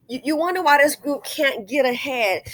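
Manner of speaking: normal talking voice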